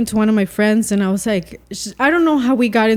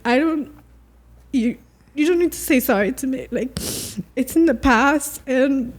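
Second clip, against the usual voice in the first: gentle reassurance; 4 semitones higher than usual. Retold or imitated. Reassuring her crying dad.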